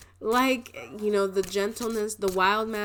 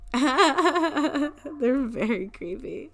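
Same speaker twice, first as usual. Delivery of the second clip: laughing; 7 semitones higher, 6 dB louder, much slower than usual. Real, not acted. Laughing mid reading.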